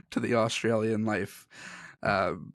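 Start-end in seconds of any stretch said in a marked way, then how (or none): none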